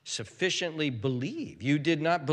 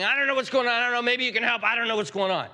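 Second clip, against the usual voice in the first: high, run-together mock helplessness; helpless dithering; 7 semitones higher, much faster than usual. Faithless people unsure Jesus can help, mock dithering. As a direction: babble it as a clueless doubter, pitched up.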